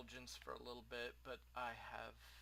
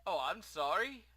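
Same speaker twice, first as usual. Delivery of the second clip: higher, louder startled apology; flustered shopkeeper, pitched up; blurting shopkeeper; 7 semitones higher, 12 dB louder than usual. Acted. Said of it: "the shopkeeper blurts out".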